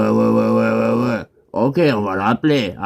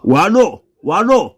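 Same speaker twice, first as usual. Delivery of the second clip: loud, high hello; raised phone-greeting voice; calling into phone; 8 semitones higher, 4 dB louder than usual. Acted. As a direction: call out hello as the line picks up. Calls into the phone.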